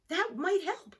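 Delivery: put-on a child taking up a suggestion; Franklin's child voice. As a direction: lift it up brightly.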